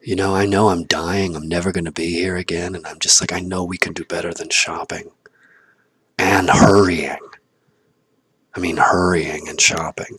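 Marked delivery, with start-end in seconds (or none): none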